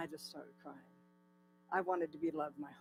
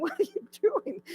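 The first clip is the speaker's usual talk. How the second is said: much higher, incredulous mock whine; exasperated squeal; 6 dB louder than usual. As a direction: squeak it out in disbelief at the bird. Quoted question to the bird.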